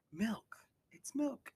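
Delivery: put-on high, hushed kid's voice; small, high-pitched mimicry; imitated dawning realization. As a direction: voice a kid piping up with a sudden discovery. Acts kid realizing milk is weakness.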